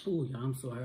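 ordinary delivery

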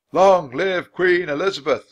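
loud, high cheering shout; loud, high rousing cry of loyal subjects; crowd shout. A narrator's reading. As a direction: roar it like a whole crowd on its knees. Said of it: "the crowd shouted".

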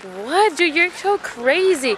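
high, drawn-out outraged comeback; retold indignation. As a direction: act out an incredulous argument. Retold quarrel, customer fires back.

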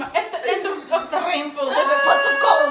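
lilting Irish, ending in a loud shout; Irish accent. Pretend. Player acting out an Irish clue in a hammed-up Irish accent.

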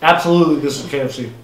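speaking between bites; talking while chewing. Real, not acted. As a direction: mouth partly full of food. Seen 0: Middle speaker bites chicken until 0.8s, then talks, likely still chewing.

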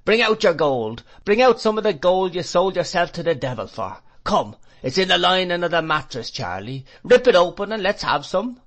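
put-on high, loud street-boy taunts; jeering shout. A narrator's reading. Boys skirmishing about the madman's shop, taunting shouts. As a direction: call it out boldly, pitched well up. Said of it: "shouting that legend".

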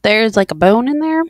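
loud, eating spicy wings; mouth full of hot food. Real, not acted. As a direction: talk through a burning mouth. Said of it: "my mouth is on fire".

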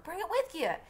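high exasperated holler. Acted. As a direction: yell it in disbelief. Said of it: "Jem hollered".

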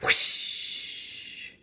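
breathy rocket whoosh; whoosh sound. Acted. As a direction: blow it out like a rocket taking off. Rocket takes off.

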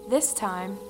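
ordinary delivery

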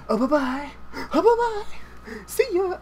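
high, singsong mock goodbye; mocking farewell. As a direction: taunt the loser in a silly high voice. Taunting the cleaned-out loser.

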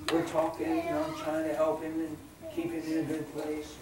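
ordinary talk

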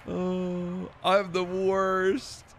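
slow, loud, high self-pitying wail; drawn-out moan. Real, not acted. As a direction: stretch every word like a lament. Streamer bemoans own play.

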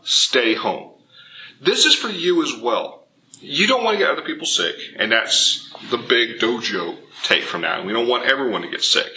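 ordinary talk

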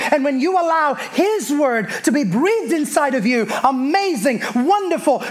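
rhythmic sermon with stretched key words; impassioned preaching. Real, not acted. Preacher reminding the church of God's word, impassioned preaching. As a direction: punch out each phrase and draw it out for the congregation.

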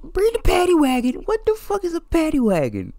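high-pitched disbelief; incredulous. Real, not acted. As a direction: squeak it out in total disbelief. Baffled by the suspect's request.

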